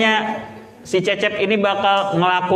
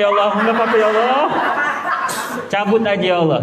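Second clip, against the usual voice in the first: rejected lonely guy; 6 semitones higher, faster than usual. Acted. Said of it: "Itu yang kayaknya yang bahagia". (jomblo yang ditolak minta dicabut nyawanya, suara tinggi bercanda)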